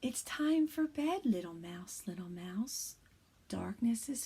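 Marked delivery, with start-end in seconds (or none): none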